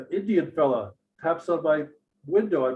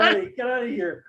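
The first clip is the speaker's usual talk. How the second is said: high mock-angry order to leave; retold angry shout; 8 semitones higher than usual. Guest to returning drunk at night, angry shout.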